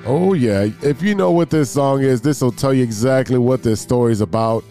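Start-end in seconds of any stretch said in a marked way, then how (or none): none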